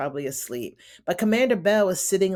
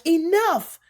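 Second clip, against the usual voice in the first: high, loud hammed-up command; mock shout; 11 semitones higher, 5 dB louder than usual. Commander silencing his wife, mock shout. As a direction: cut her off with one sharp shouted word.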